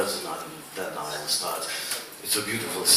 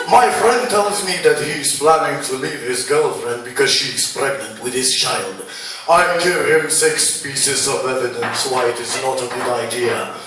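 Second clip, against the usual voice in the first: slam poem recital; 4 semitones higher, 7 dB louder than usual. Acted. Poet telling a crowd evidence against fatherhood, declamatory recital. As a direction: announce each statement forcefully, then ease down.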